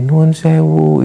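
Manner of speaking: put-on lightly eerie old woman, held syllables; old woman's voice. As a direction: imitate an old lady's lingering call. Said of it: "Nenek-nenek yang suaranya itu tadi".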